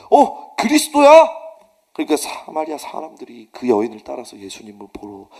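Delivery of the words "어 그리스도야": high, slow, drawn-out amazement; amazed cry. Retold or imitated. Samaritan villagers hearing of Christ, drawn-out amazement. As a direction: gasp it out like stunned villagers.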